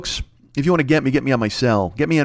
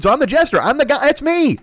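very high, louder comic protest; mock exasperated outburst; 14 semitones higher, 4 dB louder than usual. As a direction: pitch way up in hammed-up indignation. Mock outburst at being the jester.

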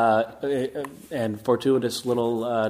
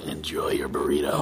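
Castiel voice; 12 semitones lower than usual. Acted. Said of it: "now do the Castiel".

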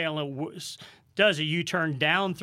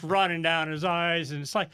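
ordinary talk

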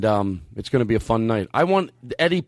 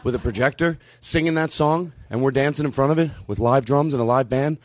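ordinary delivery

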